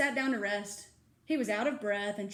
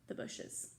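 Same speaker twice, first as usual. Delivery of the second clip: whispering; 14 dB quieter than usual. A narrator's reading. Peter hides under bushes.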